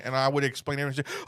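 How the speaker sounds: normal talking voice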